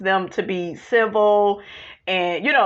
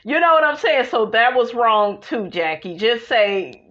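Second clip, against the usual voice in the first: ordinary talk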